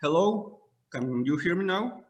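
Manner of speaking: ordinary talk, nothing marked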